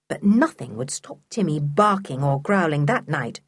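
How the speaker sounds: ordinary delivery, nothing marked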